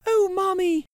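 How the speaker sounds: put-on very high bright child's voice; little girl. Girl begging her mother for the bear, high child voice. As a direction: squeal it like an excited little girl.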